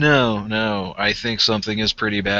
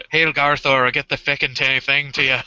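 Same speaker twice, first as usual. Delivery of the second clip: put-on Irish accent; 3 semitones higher than usual. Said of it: "I was trying to do it real".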